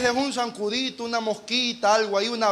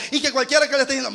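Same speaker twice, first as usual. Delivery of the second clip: ordinary delivery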